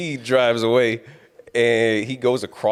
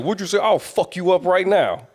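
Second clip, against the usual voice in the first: higher and a little louder, a hammed-up threat; mock angry tough guy; 10 semitones higher, 3 dB louder than usual. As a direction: play the hothead in the parking lot as a mocking caricature. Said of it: "he was like".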